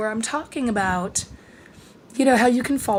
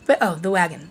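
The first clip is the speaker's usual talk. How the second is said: ordinary talk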